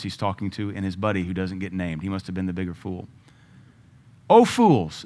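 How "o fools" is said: louder, higher mock rebuke; Jesus rebuking. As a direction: scold them as Jesus. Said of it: "this is Jesus".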